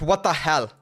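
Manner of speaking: normal talking voice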